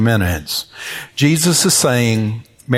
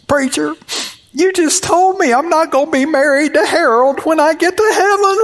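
imitating the weeping lady. Preacher mimics a weeping lady missing her husband, high mock wail. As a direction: squeal it like the heartbroken church lady. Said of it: "And she said".

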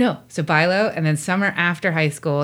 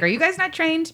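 high, incredulous question; 10 semitones higher than usual. Retold or imitated. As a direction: ask it in disbelief, pitched up. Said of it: "I did say".

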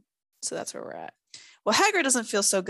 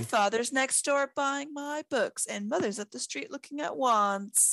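higher, slow, put-on drawl; bored drawl; 4 semitones higher than usual. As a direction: drag it out like a bored, stuck-up kid. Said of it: "In a bored, drawling voice".

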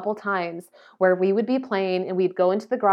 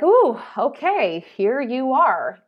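a higher, slow, grudging "ooh, okay"; resentful greeting; 5 semitones higher, 4 dB louder, slower than usual. Retold or imitated. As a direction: greet someone you resent through a sigh. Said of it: "I felt that resentment and that anger".

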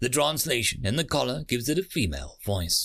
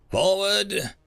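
barked command; 7 semitones higher, 4 dB louder than usual. A narrator's reading. Guard orders the line forward.

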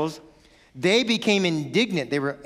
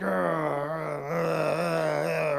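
softer, drawn-out grumbling arr; grumpy growl; 4 dB quieter, much slower than usual. Acted. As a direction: growl like a grump being told off. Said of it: "Why are you so grumpy?".

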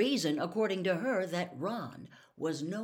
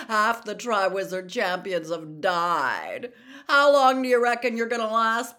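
loud, jeering schoolboy bully voice; loud mocking sneer at a rival; sneering Malfoy; 9 dB louder than usual. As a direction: taunt him like a smug rich bully. Malfoy taunting Harry.

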